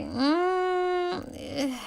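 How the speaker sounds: high, wavering mm of reluctance; a squirmy, unsure little hum; hesitant whimper. A narrator's reading. She hesitates at his cruise invite, a high uneasy hum.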